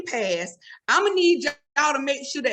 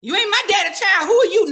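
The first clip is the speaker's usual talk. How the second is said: higher, louder offended challenge; indignant siblings; 7 semitones higher, 6 dB louder than usual. Acted. Grieving siblings confront a stranger at the casket, indignant and loud. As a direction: snap back at the stranger, affronted. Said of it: "they go".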